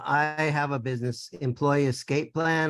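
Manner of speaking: ordinary talk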